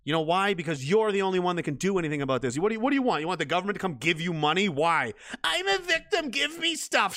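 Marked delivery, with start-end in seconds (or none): 5.4-7.2 high-pitched mock whine; whining victim. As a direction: whine like someone demanding handouts.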